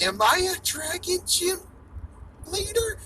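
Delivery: high squeaky mock question. To the gym leaders, a sky-high mock question. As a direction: ask it in a sky-high silly voice. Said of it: "be like".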